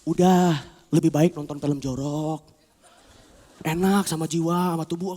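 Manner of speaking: low, slow temptation; drawn-out, soft coaxing; sly coaxing. Acted. Tempter nudging the soul toward sin, slowly coaxing.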